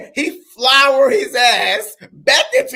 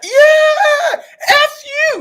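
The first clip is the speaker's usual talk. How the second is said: much higher, louder mock yell of defiance; mock defiant shout; 6 dB louder, much slower than usual. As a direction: bark it out like a fired-up coach. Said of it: "When he's like".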